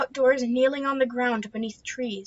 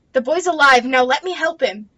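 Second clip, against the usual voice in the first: louder, insistent; firm command; 3 semitones higher, 8 dB louder than usual. Acted. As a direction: make her listen. Calming the hysterical mother.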